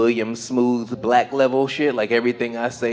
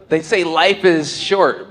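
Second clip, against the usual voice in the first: normal talking voice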